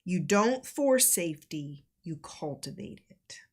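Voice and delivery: slow, measured delivery with long pauses; deliberate, reflective teaching read; reading out guidance. Acted. Reads out guidance on emotional safety.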